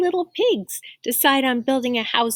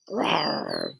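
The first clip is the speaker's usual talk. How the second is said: low animal growl; 6 dB quieter than usual. Acted. Reader growling like a wolf lurking, growl. Growl imitated while reading story.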